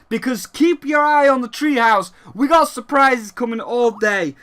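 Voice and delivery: loud, high hyped showman voice; booming Reggie impression. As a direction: shout it like a hyped presenter. Imitating Reggie's announcement.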